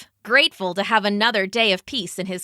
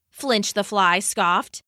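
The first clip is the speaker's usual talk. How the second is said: plain reading voice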